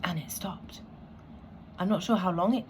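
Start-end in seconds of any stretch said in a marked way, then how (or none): 0.0-0.8 hushed. Real, not acted. Quiet relief after panic passes.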